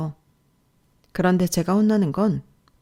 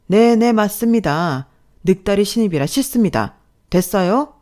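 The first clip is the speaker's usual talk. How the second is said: sarcastic concession; 4 semitones higher, 6 dB louder than usual. Acted. Boss to older newcomer, sarcastic admission. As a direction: agree mockingly, then challenge.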